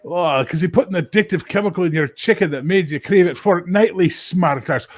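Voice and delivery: sarcastic dad answering his son; a hint of a snarky Scottish dad.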